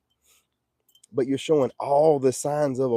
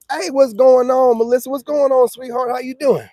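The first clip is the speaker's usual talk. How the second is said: loud flirty greeting; 7 semitones higher, 5 dB louder than usual. Acted. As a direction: call out to her, stretched and cheerful.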